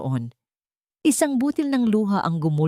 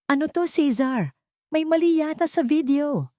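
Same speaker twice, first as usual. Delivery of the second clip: high, alarmed cry at her husband; shouted in alarm; 10 semitones higher than usual. A narrator's reading. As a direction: call out in panic, pitched high. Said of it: "Sigaw ni Tala".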